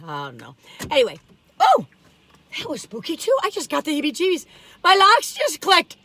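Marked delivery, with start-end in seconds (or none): 4.8-6.0 spooked. Real, not acted. Car locks click by themselves.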